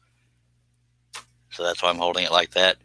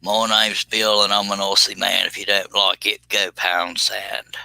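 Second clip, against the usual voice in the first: mock Australian trash talk; put-on Australian accent, mild insult; Aussie Man Bill; slower than usual. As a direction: play a cocky Aussie, slowing at the end. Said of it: "I was trying to be an Australian talking crap".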